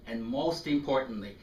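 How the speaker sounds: ordinary talk, nothing marked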